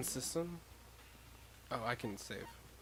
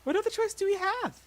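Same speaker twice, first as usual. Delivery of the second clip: put-on higher, louder resigned girl; 5 dB louder than usual. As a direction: shrug it off in a higher voice.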